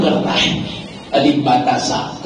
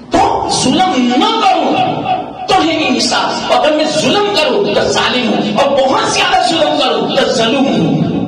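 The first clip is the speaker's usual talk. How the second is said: high, emphatic sermon delivery; impassioned preacher building an argument; fervent preaching; 10 semitones higher than usual. Real, not acted. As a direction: hammer out each case to the congregation. Preaching rises to a point.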